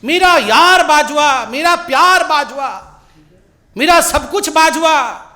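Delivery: high, drawn-out imitation of fawning devotion; mimicked adoring chant, stretched out and high; mock gushing affection. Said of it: "कह रहे थे बाजवा जी से गले मिलके".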